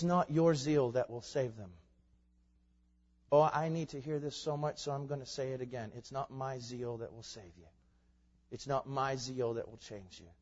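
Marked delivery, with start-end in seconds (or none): none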